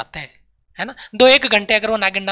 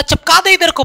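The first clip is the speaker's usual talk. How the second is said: mock order to slap; 9 semitones higher, 7 dB louder than usual. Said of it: "बोला किधर को?".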